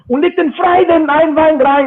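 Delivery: high, loud fake German gibberish; mock German rant. Recalling the German guy's rant, mock German. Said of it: "the German guy was like".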